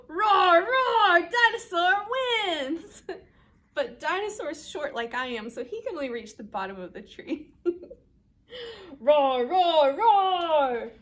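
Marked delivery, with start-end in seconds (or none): 0.1-3.0 loud, high roars into a cheer; roar, then declare victory; triumphant, boisterous shout; dinosaur victory roar. Acted. 9.0-10.9 loud triple roar; booming playful roars; dinosaur roars. Acted. Reads aloud Dinosaur's roars.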